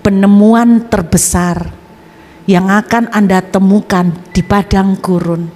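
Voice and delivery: slow, deliberate sermon voice; preaching. Acted. Preacher exhorts the congregation, slow and earnest. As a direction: preach it slowly to the church.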